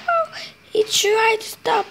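plain reading voice

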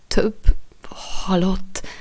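halting, bewildered repeat; confused echo. A narrator's reading. Jack echoing the boy's words in confusion. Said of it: "ismételte Jack zavartan".

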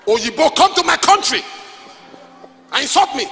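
angry outburst. Retold or imitated. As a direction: throw the insult back in anger. Retold man quits angrily.

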